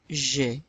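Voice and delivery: G said as soft "zhay"; French G, rhyming with "way"; French letter G. Shown as a demonstration. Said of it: "the G is pronounced".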